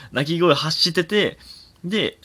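ordinary talk, nothing marked

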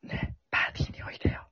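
breathy seductive whisper; [name] whisper. Shown as a demonstration. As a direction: whisper it close, like [name]. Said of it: "だってあれすごい囁いてたから".

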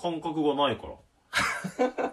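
slow, careful words through a numbed mouth. Real, not acted. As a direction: speak slowly so you don't bite your tongue. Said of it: "ゆっくり喋るしかないよ".

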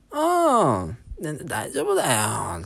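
put-on high, slow foreign-accented doctor; doctor reassuring in broken Japanese accent. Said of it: "片言なんですね".

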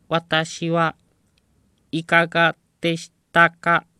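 high, very loud machine voice; robot voice. Retold or imitated. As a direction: blare it like a talking machine. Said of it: "催促してくるんですね".

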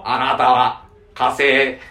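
slow, robotic alien announcement; 我々は火星人だ said very slowly, like a cartoon alien; Martian voice. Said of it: "ドラえもんの発想".

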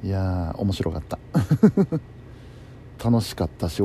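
ordinary talk, nothing marked

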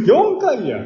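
high, loud punchline; loud joking correction. Real, not acted. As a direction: blurt it out as a joke. (大声でボケる)

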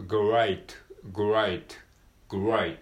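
great with g and r blended, no vowel between them; clear demo of great. Shown as a demonstration. Teacher modelling great for Japanese learners. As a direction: say great as one crisp syllable. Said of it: "子音が二つ続くぐ、".

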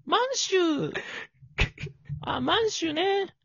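high, slow character voice; weak character voice. Shown as a demonstration. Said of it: "弱っ。". (高くゆっくりした弱い声)

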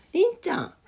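high sweet call to a pet; calling the cat. Real, not acted. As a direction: coo the cat's name up high.

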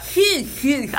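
impression call. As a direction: fire off "ヒューヒューヒュー" like a playful reply. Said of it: "返事する時". ([name]の返事を笑いながら真似して)

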